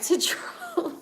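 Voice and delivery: giggling through the words; laughing. Real, not acted. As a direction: laugh while trying to get back on track. Laughing at her own simp remark.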